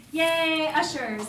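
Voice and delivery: high, loud "yay" for the crowd; excited cheer. Real, not acted. Host cheering the ushers tonight, excited cheer. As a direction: cheer them on brightly and loudly.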